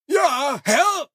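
high, eager servant call from the hall; Igor calling. Acted. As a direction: Igor answers his master. (hohe Igor-Stimme, rufend)

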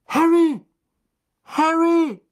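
high, loud cry of the name; urgent shout. A narrator's reading. Someone rousing Harry on the ground, urgent shout. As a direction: call out loudly in alarm.

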